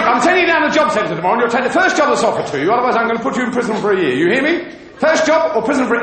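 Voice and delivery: fast, firm sentencing from the bench; stern judge. Retold or imitated. Judge warning the Irishman in court, fast stern delivery. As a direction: rattle off the ultimatum like a stern judge.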